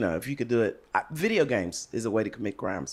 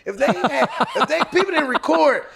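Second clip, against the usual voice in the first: high, loud laughter running through the words; laughing; 11 semitones higher, 5 dB louder than usual. Real, not acted. Telling the podcast host about the cop's clothesline, real laughter.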